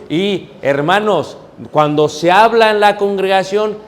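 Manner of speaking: usual speaking voice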